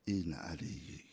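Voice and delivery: soft whispered lament; mournful whisper. Acted. Preacher whispering Ali's suffering to the mourners. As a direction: grieve it under the breath.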